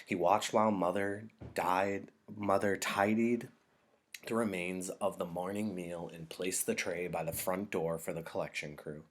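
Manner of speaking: ordinary delivery, nothing marked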